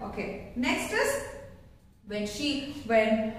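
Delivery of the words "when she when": normal talking voice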